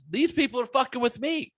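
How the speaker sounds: high, heated put-on voice of a fed-up driver; indignant retort. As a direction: vent at the bystander as if you were there. Voicing an imagined indignant retort.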